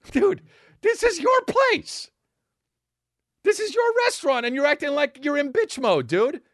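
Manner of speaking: can't believe they'd allow it; incredulous outburst. Real, not acted. Comic ranting at owners who obey, high and incredulous. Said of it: "incredulous rant".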